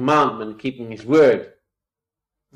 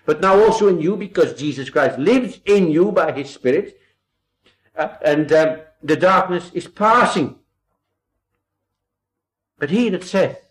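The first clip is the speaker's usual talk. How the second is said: louder, raised preacher's voice; fervent preaching, louder and raised; 6 semitones higher, 8 dB louder than usual. Acted. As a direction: preach it out to the congregation. Preaching at full force.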